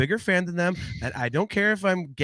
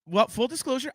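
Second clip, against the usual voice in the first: normal talking voice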